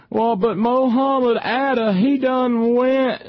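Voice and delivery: high, slow, drawn-out yokel caricature; mock a ranting wing nut in a high put-on voice, stretching every word; hammed-up ignorant partisan hollering; screaming wing nut. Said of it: "wing nuts would scream".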